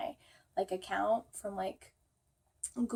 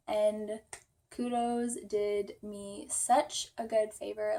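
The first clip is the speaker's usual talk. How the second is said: ordinary talk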